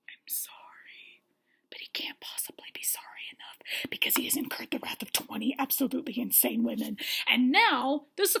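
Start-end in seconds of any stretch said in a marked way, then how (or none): 0.1-7.0 soft unvoiced whisper; mock timid apology; feeble whisper. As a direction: apologise in a tiny scared voice. Voicing Peter's feeble apology.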